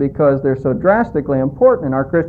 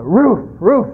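higher, playful mock dog bark; 4 semitones higher than usual. Talking dog joke punchline.

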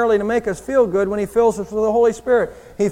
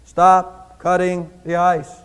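booming voice; 3 semitones lower, much slower than usual. Retold or imitated. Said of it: "he hears this voice".

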